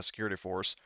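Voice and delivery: ordinary talk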